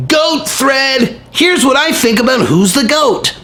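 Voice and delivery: high mocking shout of the tweet; mocking fake Conor tweet voice. Vlogger mocking a fake Conor tweet, high mocking shout. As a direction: sneer out the tweet like a wannabe. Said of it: "That does not sound like Conor to me".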